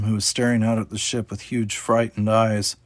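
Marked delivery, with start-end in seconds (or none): none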